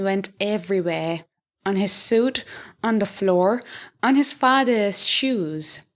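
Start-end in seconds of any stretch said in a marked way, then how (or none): none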